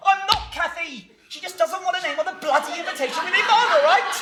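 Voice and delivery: protest, at the end of your patience; exasperated. Acted. Fiance defending himself to Cathy, exasperated. Acted quarrel, exasperated son.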